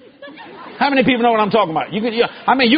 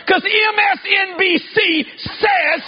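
higher, slow shouted preacher's cry; fiery preaching; 8 semitones higher, slower than usual. Acted. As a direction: stretch every letter out, shouting. Preaching climax.

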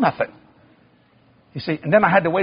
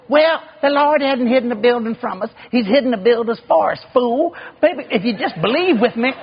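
imitating a wife talking back, pitched up; sassy retort; 7 semitones higher than usual. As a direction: talk back to him in a high voice. Wife's retort, acted.

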